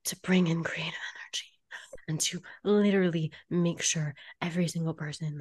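strained mock-solemn whisper; burdened whisper. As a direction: whisper it as if crushed by duty. Acts out her burdened former self.

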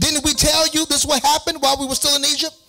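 mock panicked Israelites. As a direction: whine it like scared people in the desert.

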